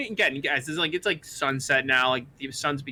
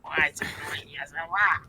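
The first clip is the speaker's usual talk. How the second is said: whispered Kobold; 3 dB quieter than usual. Acted. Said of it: "saying it in Kobold".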